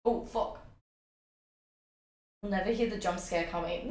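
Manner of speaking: ordinary talk, nothing marked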